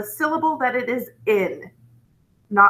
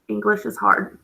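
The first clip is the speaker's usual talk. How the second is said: usual speaking voice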